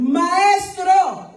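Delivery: high, loud panicked cry of maestro; frightened shout. Acted. Disciples shout to wake Jesus in the boat, panicked. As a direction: yell it like men sinking in a storm.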